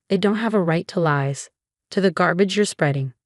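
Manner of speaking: ordinary delivery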